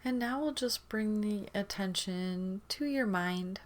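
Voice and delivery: ordinary talk, nothing marked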